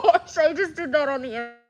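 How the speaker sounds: big embarrassed laugh; laughing loudly. Real, not acted. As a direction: burst out laughing in disbelief. Laughs at her own on-air slip.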